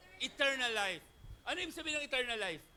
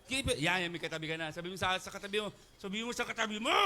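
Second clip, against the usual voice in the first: normal talking voice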